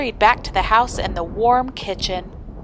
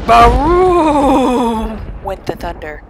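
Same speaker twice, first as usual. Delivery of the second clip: loud slow boom; booming thunder shout; 4 semitones higher, 9 dB louder, slower than usual. A narrator's reading. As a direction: roar the thunder word out. Thunder sound read aloud.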